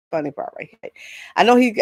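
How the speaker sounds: usual speaking voice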